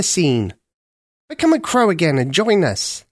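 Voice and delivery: put-on a wise old bird coaxing her along; raspy cawing crow. A narrator's reading. Senior crow voiced in story.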